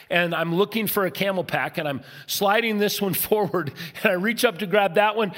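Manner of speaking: ordinary talk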